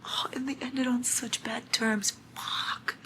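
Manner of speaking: hushed regret; regretful whisper. Real, not acted. Reader regretting a broken friendship, whispering. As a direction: whisper it, sorry and tired.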